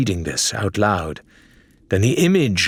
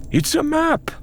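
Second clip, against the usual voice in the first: high, bright cry of discovery; triumphant exclaim. A narrator's reading. Faisal shows his friends the glowing map, triumphant. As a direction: announce it like a victory. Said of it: "Faisal exclaimed triumphantly".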